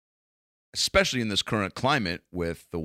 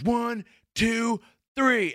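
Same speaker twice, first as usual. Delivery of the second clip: high, cartoonish bully cop voice; mock a cocky roided-up officer; aggressive cop; 14 semitones higher, much slower than usual. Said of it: "and he goes".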